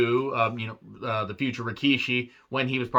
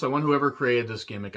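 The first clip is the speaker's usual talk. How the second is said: ordinary talk, nothing marked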